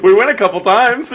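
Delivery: words spilling out through loud, high laughter; laughing. Real, not acted. Cohost laughing at the skate park memory, laughing loud.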